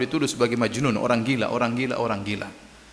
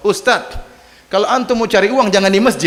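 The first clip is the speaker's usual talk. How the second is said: mocking taunt; 5 semitones higher, 5 dB louder than usual. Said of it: "dia nge-, ngejek ustadz".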